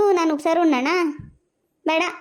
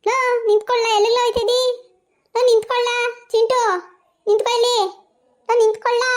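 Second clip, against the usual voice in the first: much higher, loud calls after him; calling out; 8 semitones higher, 3 dB louder than usual. Acted. Mother shouting after her son as he storms out. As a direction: yell at him to stop.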